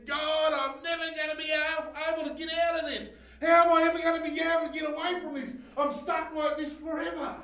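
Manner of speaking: high, loud anguished pleading, rushing; desperate cry to God. Retold or imitated. Recalling his despair, crying out to God in anguish. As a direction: cry out to God as if trapped forever.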